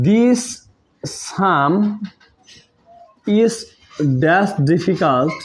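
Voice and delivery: each word drawn out very slowly; slow dictation. Shown as a demonstration. Teacher slowly dictating the blank in a sentence. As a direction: spell it out slowly for students to copy.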